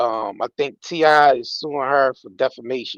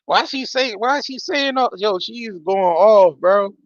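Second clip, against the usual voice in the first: disbelief; 7 semitones higher than usual. Real, not acted. Shocked by what he reads.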